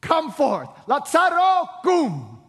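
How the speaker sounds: high, loud shout; Jesus shouting at the tomb; commanding shout. Acted. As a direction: bellow the command. Jesus calls dead man forth.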